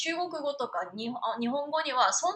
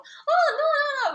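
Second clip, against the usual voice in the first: much higher, louder mock despair; high wailing no; 7 dB louder than usual. Singer telling her coach she can't do it, high mock despair. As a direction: wail it like you're giving up.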